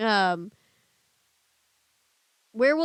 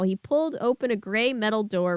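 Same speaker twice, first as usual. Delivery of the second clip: usual speaking voice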